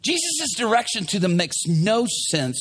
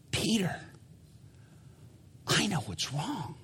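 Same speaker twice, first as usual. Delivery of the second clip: hushed, soft-spoken aside; gentle Jesus; 12 dB quieter than usual. Acted. Jesus quietly telling Peter what's wrong, gentle. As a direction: say it very softly, unhurried. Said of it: "Jesus stops and says".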